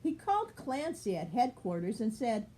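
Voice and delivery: plain reading voice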